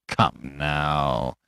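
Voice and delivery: low, soft sneer. A narrator's reading. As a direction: sneer it quietly, pitched well down. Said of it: "sneered the other".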